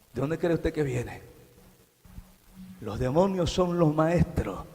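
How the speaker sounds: ordinary talk, nothing marked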